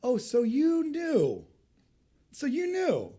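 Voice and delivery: wounded master confronting his servant; offended king. Acted. King to the servant who hid the gold, hurt and offended. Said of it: "I think he is offended by this".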